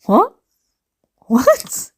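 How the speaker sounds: mock disbelief. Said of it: "I was just reading it like".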